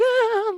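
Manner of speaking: high, loud "ah" of alarm; mock scream. Date to the man staring, mock scream. Recoils as he stares, retold.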